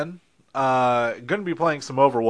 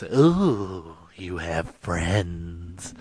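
teasing; 7 semitones lower, 4 dB quieter, much slower than usual. Acted. Teasing aside, low and slow.

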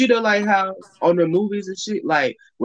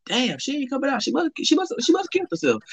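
shocked; 9 semitones higher than usual. Retold or imitated. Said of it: "I said".

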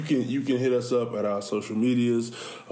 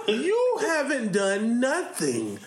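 laughing; 11 semitones higher, slower than usual. Retold or imitated. Retold scolding of coach.

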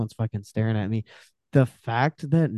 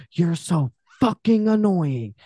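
higher, slow, alien possessed voice; a slow sneer from the thing inside her; demonic snarl; 6 semitones higher, slower than usual. Retold or imitated. Possessed girl snaps at him.

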